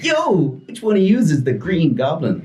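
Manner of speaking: high, slow, showy superhero greeting; hammed-up Spider-Man. Storyteller greets the kids as a high, slow Spider-Man. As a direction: boom it out like a party entertainer.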